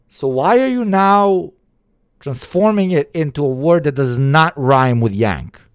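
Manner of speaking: loud, high, baffled protest; exasperated rant. Real, not acted. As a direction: demand an answer in disbelief. Ranting at slur logic.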